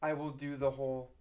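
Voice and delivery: usual speaking voice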